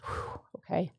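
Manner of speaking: a quiet exhale of puppy relief; relieved puppy. Pretend. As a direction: voice the puppy finally letting go. Puppy voiced settling down.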